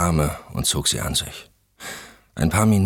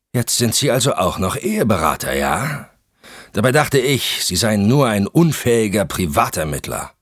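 sneering husband; 7 semitones higher, 5 dB louder than usual. Acted. Husband mocks the investigator.

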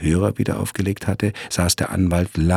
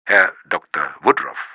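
rough voice. Acted. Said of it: "eine raue Stimme".

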